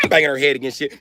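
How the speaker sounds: high muffled wail; muffled high cries. Retold or imitated. Woman in the back seat, mock muffled cries.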